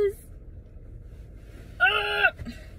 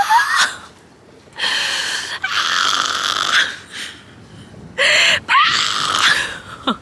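shrieking laughter; 9 dB louder than usual. Real, not acted. Wild laugh outdoors.

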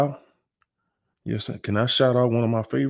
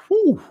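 tired whew. Real, not acted. Still hot from the workout.

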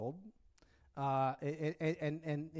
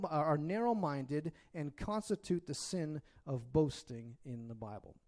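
ordinary talk

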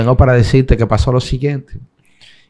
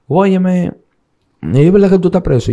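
higher, alarmed woman's voice asking; a lightly voiced anxious woman demanding the truth; voicing his partner; 9 semitones higher, 5 dB louder than usual. Partner on the phone asking if he's in jail, higher alarmed voice. Partner asks him if jailed.

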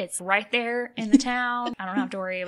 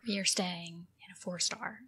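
whisper; 10 dB quieter than usual. Pretend. Joking secret whisper to friend.

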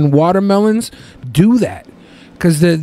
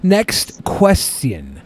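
slow announcer read; much slower than usual. Acted. Host reads out next question.

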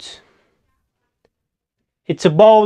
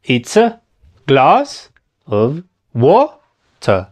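"It's a glass of water" very slow, chunk by chunk; slow teaching breakdown of "It's a glass of water"; broken-down demo; 2 semitones lower, much slower than usual. Shown as a demonstration. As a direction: say "It's a / glass / of / wa / ter" with pauses between pieces. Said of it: "¿Ya viste? Y así va".

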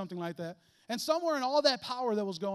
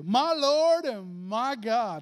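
louder and very slow, in awe; exclaiming; 3 semitones higher, 7 dB louder, much slower than usual. Acted. Thomas recognizing Jesus, awed exclamation. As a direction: cry it out in wonder. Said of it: "he exclaimed".

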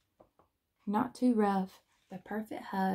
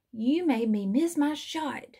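higher, louder storybook yell; 4 semitones higher, 5 dB louder than usual. A narrator's reading. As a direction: yell the complaint at a teammate, raised but contained. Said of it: "Badge yelled".

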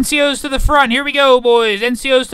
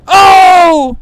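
surprised shout; 6 semitones higher, 8 dB louder than usual. Real, not acted. Reacting loudly in fight.

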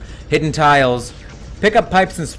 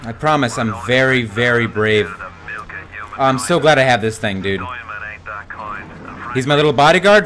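usual speaking voice

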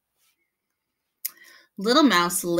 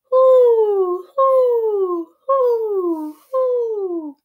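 much higher, louder hoo hoo; owl hoots; 9 dB louder, much slower than usual. A narrator's reading. Narrator imitating an owl hooting in the dark, high and loud. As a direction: hoot like an owl in the night.

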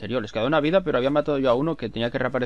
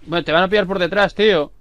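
raised urgent call; loud warning; 6 semitones higher, 7 dB louder than usual. Real, not acted. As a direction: shout a warning to a teammate. Warning teammate during fight.

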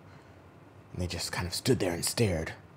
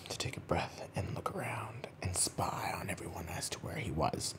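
whispered narration; 10 dB quieter than usual. A narrator's reading. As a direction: whisper it like sneaking past a sleeping child. Suspense whisper in bedtime story.

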